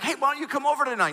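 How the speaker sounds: high, quick, eager invite; outgoing invitation. Retold or imitated. Husband inviting new friends from social circles over, outgoing. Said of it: "I'm fairly outgoing".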